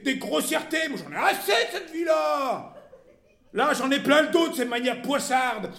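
loud, high-pitched shouting tirade; enraged outburst. A narrator's reading. Monsieur finally losing his temper at his wife, enraged outburst. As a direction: explode after long restraint, shouting each grievance. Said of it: "il s'écria".

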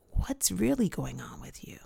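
hushed, probing question; soft whisper. Retold or imitated. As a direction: whisper it, gently pressing. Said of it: "And she goes".

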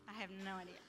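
low, soft, mildly tearful admission; holding back tears. Retold or imitated. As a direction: admit it quietly, keeping tears in. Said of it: "trying not to cry uncontrollably".

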